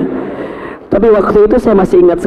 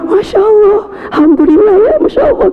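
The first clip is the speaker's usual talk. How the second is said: high, cracking cry of mock delight, much higher; forced joyful exclamation; 13 semitones higher than usual. Husband to pregnant wife, hiding his worry: high, cracking, put-on joy. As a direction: oversell the gratitude so your worry stays hidden.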